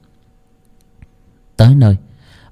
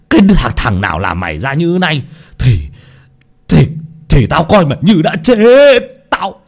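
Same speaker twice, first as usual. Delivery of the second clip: anguished father cursing his daughter, climbing higher; enraged hissing rebuke; 5 semitones higher than usual. A narrator's reading. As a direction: drag out the reproaches into a high, furious wail. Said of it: "Ông Chí rít lên". (giọng rít lên chửi mắng, đau đớn)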